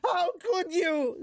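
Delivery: high, slow, wounded cry; upset high-voiced Zenoba. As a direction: wail like a heartbroken craftsman. Zenoba voiced, upset at broken sculpture.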